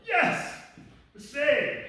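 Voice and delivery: high, loud shout of triumph; jubilant cheer. Retold or imitated. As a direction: cheer as if rescued at last. Reliving relief at being rescued.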